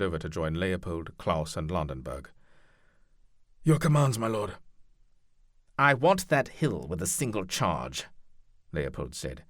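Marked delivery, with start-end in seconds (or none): 3.6-4.6 higher, louder deferential captain; knight captain voice. Acted. Franco addressing the duke. 5.8-8.0 lordly commander, louder voice; commanding duke. Acted.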